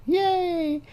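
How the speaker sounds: high falsetto squeal of joy; mock girly cheer. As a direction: squeal like a thrilled teen girl. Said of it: "she acts really happy".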